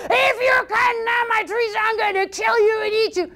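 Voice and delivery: high, shouted troll threat; roaring troll. Acted. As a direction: roar at the boy like a troll. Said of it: "big huge troll".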